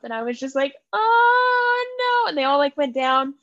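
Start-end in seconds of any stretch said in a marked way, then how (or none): 0.9-2.3 high, drawn-out wail; retold cry of heartbreak; grieved reaction. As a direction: wail a long, stricken oh no. Retells reaction to deaths.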